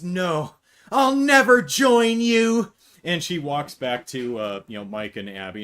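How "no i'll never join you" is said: much higher, louder, slow heroic cry; defiant refusal. Retold or imitated. Vanessa rejecting her brother, hammed-up heroic defiance. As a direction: refuse like a movie hero. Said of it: "she says".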